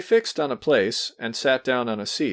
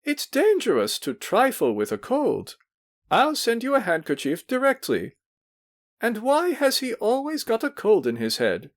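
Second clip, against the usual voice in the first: fussy matron; 13 semitones higher than usual. A narrator's reading. Matron fussing.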